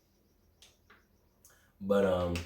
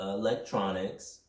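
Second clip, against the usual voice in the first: ordinary talk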